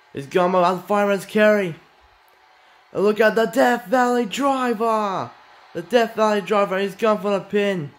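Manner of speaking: high, loud call climbing to a yelp on the kickout; excited shouting commentary. Real, not acted. Commentator calling the Death Valley driver and pin, frantic. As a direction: build the count and explode at the kickout. Commentator calls near-fall sequence.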